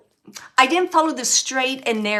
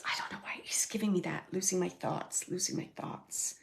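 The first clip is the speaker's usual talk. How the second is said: whispered confusion; 4 semitones lower, 11 dB quieter than usual. Real, not acted. Medium channels spirit's confusion.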